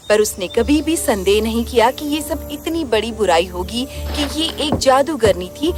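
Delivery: usual speaking voice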